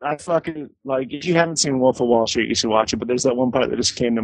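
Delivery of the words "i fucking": ordinary delivery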